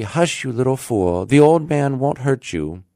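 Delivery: mother crying hush. A narrator's reading. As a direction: shush and reassure a frightened child. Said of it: "cried she".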